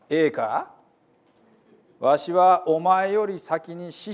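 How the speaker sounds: earnest folksy Bible reading; dialect scripture reading. Acted. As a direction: proclaim the Gospel like a storyteller from Hiroshima. Reads Gospel in Hiroshima dialect.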